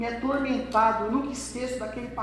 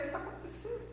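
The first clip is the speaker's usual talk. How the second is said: confused whisper; 14 semitones higher, 12 dB quieter, faster than usual. Acted. Crowd asks, confused.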